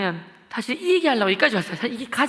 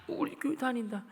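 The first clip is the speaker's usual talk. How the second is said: meek, much softer answer; timid reply; 2 semitones higher, 9 dB quieter, slower than usual. Retold or imitated. Frightened classmate answering the bully, meek and soft. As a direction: answer the bully small and scared.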